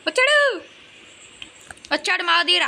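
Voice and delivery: loud, high urgent callout; excited alert. Real, not acted. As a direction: warn your teammate loudly. Enemy approaching, alerting teammate.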